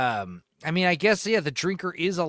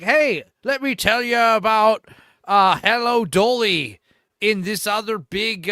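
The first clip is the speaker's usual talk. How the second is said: Chris Stuckman voice; 6 semitones higher, 7 dB louder than usual. Retold or imitated. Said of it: "if I did Chris Stuckman's voice".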